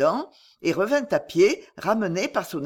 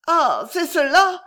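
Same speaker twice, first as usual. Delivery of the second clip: higher and louder, slow cry of recognition; anguished realization; 10 semitones higher, 6 dB louder, slower than usual. A narrator's reading. Lydie realizes she was drugged, a slow anguished cry. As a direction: let it burst out, stricken.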